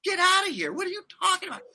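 high incredulous get outta here. Retold or imitated. Telling friend how he reacted to mailing the bike, incredulous, high. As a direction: squeal in disbelief. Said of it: "And I'm like".

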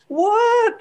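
put-on high squeaky child's What; shocked child. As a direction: squeal it like a shocked little girl. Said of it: "second level of emotion, which is tears".

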